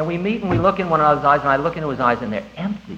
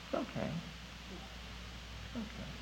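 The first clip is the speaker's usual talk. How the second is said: very soft, higher-pitched reassurance; 5 semitones higher, 25 dB quieter, much faster than usual. Real, not acted. Teacher tells students caught in forms it's okay, very soft reassurance. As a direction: murmur it gently, barely audible.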